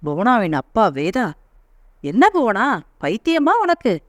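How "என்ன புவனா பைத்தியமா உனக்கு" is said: father yelling at daughter, higher; snapping in anger at her; angry father shouting at his daughter. Acted. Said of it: "என்று கத்த".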